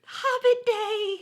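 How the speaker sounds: high, slow, giggly Hobbit Day; excited high laughing. Acted. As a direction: squeal it with glee. Repeated with delight.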